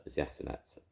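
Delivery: usual speaking voice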